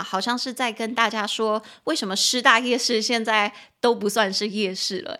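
ordinary talk, nothing marked

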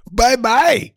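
high giggling bye-bye; laughing bye-bye. Retold or imitated. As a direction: sing it out while laughing. Laughing mock farewell.

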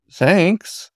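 squeaky flustered high thanks. A narrator's reading. Otter squirming beside the demon, flustered and high. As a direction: blurt it, embarrassed. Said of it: "the otter squirmed".

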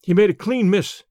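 plain reading voice

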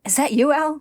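high, loud call; crying out. A narrator's reading. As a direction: call out to someone buried, voice pitched right up. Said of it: "Winry cries out".

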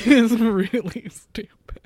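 helpless, breathy laugh through the words. Real, not acted. Co-host cracking up over a player's stupidity, laughing. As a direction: squeeze the words out while laughing. Breaking up laughing at co-host's remark.

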